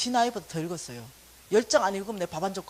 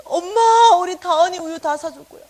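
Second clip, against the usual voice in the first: bright raised voice promising milk; loud mock promise; 8 semitones higher, 13 dB louder than usual. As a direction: call it out eagerly. Mother promising, loud quote.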